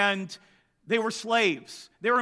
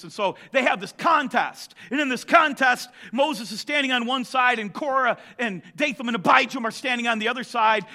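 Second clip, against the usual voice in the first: ordinary delivery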